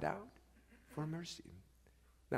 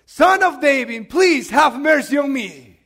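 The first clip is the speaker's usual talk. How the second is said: loud, pleading call; crying out for mercy; 4 semitones higher, 16 dB louder, slower than usual. Acted. Blind man begging Jesus to notice him, crying out loudly. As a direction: cry out loudly with a long first word. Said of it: "he was crying out for mercy".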